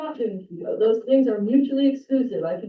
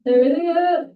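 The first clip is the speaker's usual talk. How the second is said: spooky voice; 7 semitones higher, 5 dB louder than usual. Pretend. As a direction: moan it like a ghost. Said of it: "say everything else in a spooky voice".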